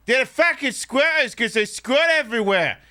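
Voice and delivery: put-on a bawdy British bloke accent, half Australian. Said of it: "not trying to do a British accent".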